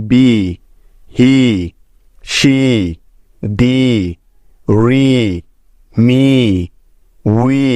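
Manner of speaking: বি and হি said slowly, each ending in a long "ee"; long e sound demo. Shown as a demonstration. Teacher listing example words for students, careful long "ee" vowel. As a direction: sound out each word, holding the final "ee" of ফ্রি. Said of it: "এর sound সবসময় ইকার হয়".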